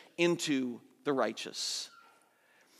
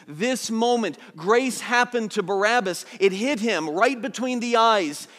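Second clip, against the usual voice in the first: fervent preaching, louder and higher; 3 semitones higher, 5 dB louder than usual. Acted. Pastor telling how grace hit Barabbas, fervent preaching. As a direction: drive it home with fire.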